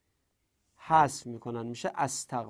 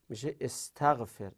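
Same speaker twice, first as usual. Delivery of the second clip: normal talking voice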